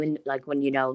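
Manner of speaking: normal talking voice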